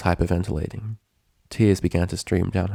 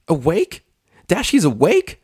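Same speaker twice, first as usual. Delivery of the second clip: much higher and louder excited squeal; 10 semitones higher, 5 dB louder than usual. A narrator's reading. Pinkie hearing through the door that Dash is awake, squealing. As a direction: squeal it with delighted disbelief. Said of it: "a voice squealed".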